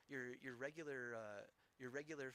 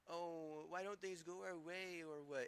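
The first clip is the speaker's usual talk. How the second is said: mocking whine; 3 semitones higher, slower than usual. Mocking the city's complaint.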